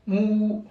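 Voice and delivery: "mou" with a drawn-out oo; long vowel. Shown as a demonstration. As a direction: hold the ou in "mou" for two beats. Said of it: "prolongation de deux temps".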